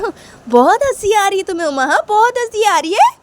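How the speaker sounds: high, loud, offended; acted indignant child; stung accusation. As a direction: confront the sister laughing at you. Sanju angry at Uma laughing.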